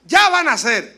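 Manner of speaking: loud, excited "Ya van a ser"; eager announcement. Retold or imitated. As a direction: blurt it out like an eager husband. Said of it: "los hombres decimos".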